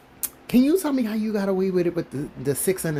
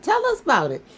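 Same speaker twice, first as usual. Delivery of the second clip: pushy demand; 11 semitones higher than usual. Pretend. Demanding the man tell all, insistent. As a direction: press him hard to spill it. Pressing for a confession.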